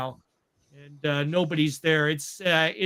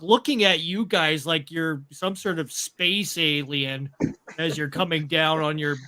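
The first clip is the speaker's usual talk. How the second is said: ordinary delivery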